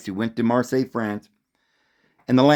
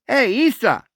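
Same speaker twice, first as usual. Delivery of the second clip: much higher call of Isa; 9 semitones higher than usual. Retold or imitated.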